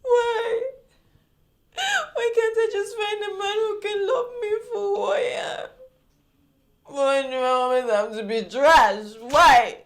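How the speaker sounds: sobbing, wailed lament; crying. Acted. Woman breaks down to brother-in-law about men, crying. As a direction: cry the questions out in anguish. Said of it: "Now you're crying".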